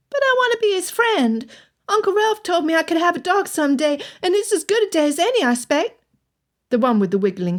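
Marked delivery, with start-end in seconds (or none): 0.1-6.0 put-on high, eager little boy; young boy voice. A narrator's reading. Young lad to Elizabeth, high eager boy voice. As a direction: play the lad pleading for a dog.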